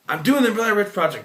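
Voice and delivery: high, loud defensive reply; retold shout back. Shouting back to sister from the basement, indignant. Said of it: "I'm like".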